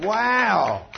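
a high, loud burst of wonder; astonished exclamation; amazed wow. Retold or imitated. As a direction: marvel out loud at the healing. Pastor reacts to the healing.

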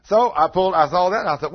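ordinary talk, nothing marked

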